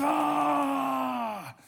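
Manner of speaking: long, high held "ah"; triumphant cry. Retold or imitated. Player coming off court after playing well, imitated triumphant cry.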